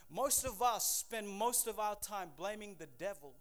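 normal talking voice

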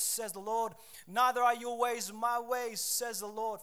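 fiery pulpit delivery; preaching at full force. Acted. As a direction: preach it out to the congregation.

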